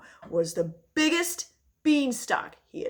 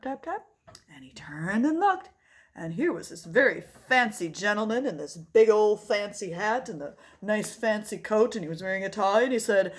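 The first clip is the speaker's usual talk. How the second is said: ordinary delivery, nothing marked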